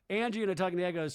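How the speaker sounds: usual speaking voice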